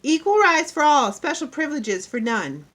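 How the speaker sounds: high, loud schoolchild recitation; quoting a slogan. A narrator's reading. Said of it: "I quoted".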